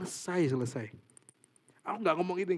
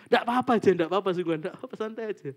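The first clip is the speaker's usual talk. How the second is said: reassuring; 4 semitones higher, 9 dB louder, faster than usual. Acted. Voicing reassurance to viewer.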